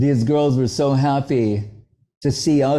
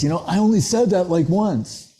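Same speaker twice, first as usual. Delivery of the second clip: lightly voiced, higher-pitched aside; higher retold protest; 7 semitones higher than usual. Yudhamanyu answering the teller, higher imitated protest. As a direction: toss it out quicker and higher, as imitation.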